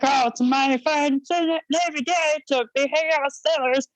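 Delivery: badly faked gruff-guy impression; salty old chief. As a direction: do a bad salty old sailor. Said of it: "he didn't sound like Herbert the Frog".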